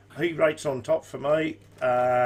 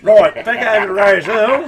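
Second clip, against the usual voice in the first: loud, higher-pitched push back to business; real raised voice, recovering from a ribbing; loud comeback; 7 semitones higher, 14 dB louder than usual. Real, not acted. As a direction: burst back in over the laughter. Moving on after laughing at jibe.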